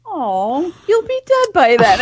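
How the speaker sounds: put-on very high, sympathetic child voice; high-pitched, hammed-up sorry kid; pitying little kid. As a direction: imitate a small child full of pity. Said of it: "looked like he felt sorry for me".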